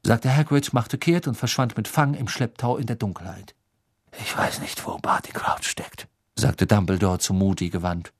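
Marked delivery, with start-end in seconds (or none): 4.2-6.1 put-on soft, almost whispered old voice; quiet, breath-led confiding; hushed. A narrator's reading. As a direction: say it under your breath. Dumbledore confers softly with Moody.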